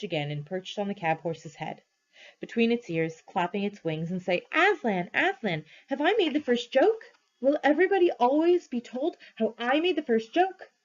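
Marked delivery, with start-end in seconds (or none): 4.5-10.7 high, excited little bird voice; eager jackdaw. A narrator's reading. Jackdaw begging Aslan about his joke, high and eager. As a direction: chirp it up high like a proud jackdaw.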